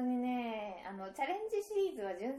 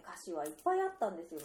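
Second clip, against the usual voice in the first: ordinary talk, nothing marked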